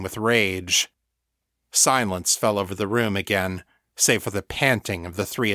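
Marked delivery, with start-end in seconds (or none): none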